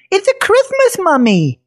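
put-on high, eager kid voice; bright excited little boy; young boy voice. Nate, a boy, speaks.

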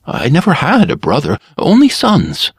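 bow and scrape with the voice; servile barber. A narrator's reading. Barber flatters his dangerous customer, servile.

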